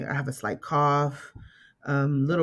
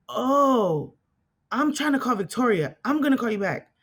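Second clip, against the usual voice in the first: high-pitched, dawning "oh"; sudden realisation; 9 semitones higher than usual. Retold or imitated. Dad who misdialled his daughter, sudden realisation. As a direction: imitate someone realising their mistake.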